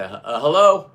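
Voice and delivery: loud, unsure radio hello; timid call into the radio; hesitant radio hello. Retold or imitated. Imitating himself on radio.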